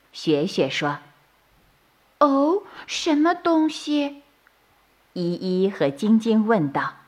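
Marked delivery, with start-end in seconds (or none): 2.2-4.2 curious bunny. A narrator's reading. Bunnies ask worriedly.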